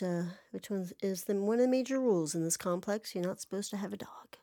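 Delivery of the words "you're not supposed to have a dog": hushed aside. Real, not acted. Aside about complex rules.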